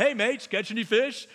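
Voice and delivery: a touch of a chummy stranger's voice; jovial Jesus. Retold or imitated. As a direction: toss it out like a friendly Aussie on the shore. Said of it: "that's how I picture it".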